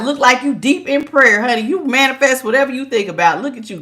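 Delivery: ordinary talk, nothing marked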